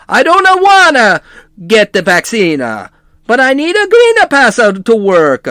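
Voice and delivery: put-on high, loud Italian accent; hammed-up Italian accent impression. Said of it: "that's actually how you do an Italian accent".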